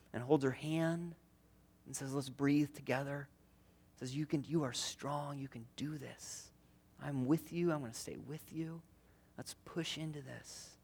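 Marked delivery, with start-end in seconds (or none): 2.1-10.7 gentle coaching. Retold or imitated. Midwife coaching through labor.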